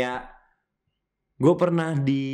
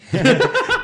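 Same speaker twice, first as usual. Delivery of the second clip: high burst of laughter; 8 dB louder than usual. Real, not acted. Laughing during the outro.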